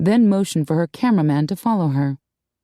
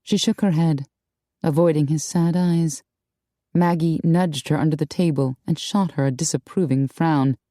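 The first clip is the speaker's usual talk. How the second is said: ordinary delivery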